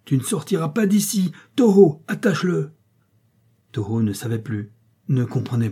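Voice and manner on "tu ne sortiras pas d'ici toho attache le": high, raised voice giving orders; yelling. A narrator's reading. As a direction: yell a command to stop him. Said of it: "hurla Van Kock". (ordre hurlé, voix aiguë)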